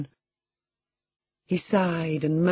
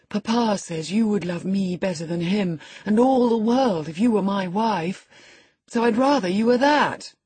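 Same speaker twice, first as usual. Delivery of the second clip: put-on higher boyish voice, climbing at the end; cheerful, wheedling boy; 6 semitones higher, 4 dB louder than usual. A narrator's reading. Boy telling his cousin he'd rather she were his wife, cheerful. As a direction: voice a boy eagerly pressing his wish.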